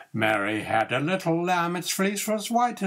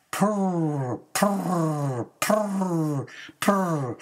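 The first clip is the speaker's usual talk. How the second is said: kitten purring; much slower than usual. Acted. Kittens purring happily over their pie, lightly voiced. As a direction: purr like a contented kitten.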